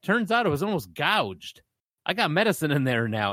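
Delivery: put-on high, smug told-you-so kid. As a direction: gloat at the teacher.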